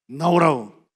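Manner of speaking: loud order to come out; executioner's shout; barked command. Retold or imitated. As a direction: call the next one out. Executioner calls next victim.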